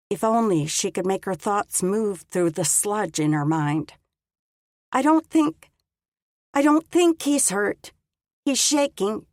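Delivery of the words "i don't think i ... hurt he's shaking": much higher, shaky and weak; high strained voice. Acted. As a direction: strain to answer through the cold. Dazed frozen woman answering.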